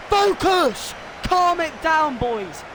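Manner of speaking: high-pitched urgent calling out; exasperated fan's yell; urgent shout. Real, not acted. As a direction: shout orders at the team from afar. Urging team to settle.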